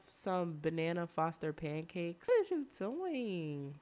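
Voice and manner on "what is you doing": high, drawn-out mock disbelief; mock scolding. Player catches game character misbehaving with laundry, mock scolding. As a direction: scold them playfully, stretching the words.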